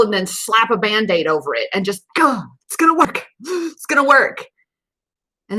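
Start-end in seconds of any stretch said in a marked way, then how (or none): 2.1-4.5 higher, hurried mock hopeful chant; hopeful self-talk. To viewers, mocking someone slapping on a Band-Aid, chirpy hopeful self-talk.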